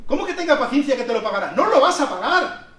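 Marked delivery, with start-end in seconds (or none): 1.5-2.7 higher and louder, laughing disbelief; incredulous exclaim. Real, not acted. Preacher to congregation, louder incredulous exclaim. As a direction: hammer home it's impossible.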